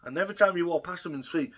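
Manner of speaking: ordinary delivery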